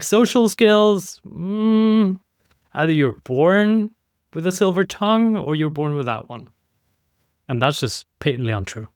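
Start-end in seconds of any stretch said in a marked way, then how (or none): 0.0-6.5 mocking belief. Mocking the born-charismatic belief.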